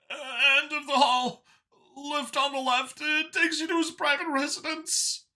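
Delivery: high, slow, shaky alien voice; frightened Xeno. A narrator's reading. Gun under his chin, Xeno gives up the way, high and frightened.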